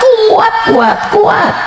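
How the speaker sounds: rally them at full force; fiery preaching. Acted. Preaching reassurance. (ceramah berapi-api, menyemangati dengan teriakan)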